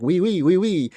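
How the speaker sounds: cartoonish French accent, lightly hammed. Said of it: "They're like".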